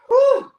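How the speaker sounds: loud, high whew of relief. Real, not acted. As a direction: blow out a big, high breath after effort. Panting after exercise.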